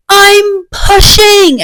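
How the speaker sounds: high, drawn-out urgent yell; mock labour yell at the midwife. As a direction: cry out that the baby is coming. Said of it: "I wake up yelling".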